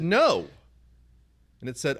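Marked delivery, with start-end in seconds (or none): 0.0-0.5 sharp, loud, high no at the phone; frustrated no. Retold or imitated. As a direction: snap a loud no at the machine. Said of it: "So I said".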